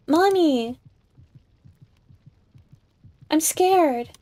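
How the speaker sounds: put-on scared child. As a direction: call for mommy like a frightened little girl. Voicing the frightened child.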